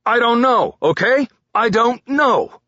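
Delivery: loud, high angry snap; barking at partner. A narrator's reading. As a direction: bark it in frustration. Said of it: "barked at his partner".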